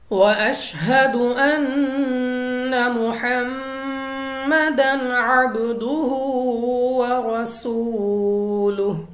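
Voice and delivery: slow, melodic, drawn-out chanting of Arabic; Quranic recitation. Shown as a demonstration. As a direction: recite with long held vowels and tajweed. Teacher recites Quran demo.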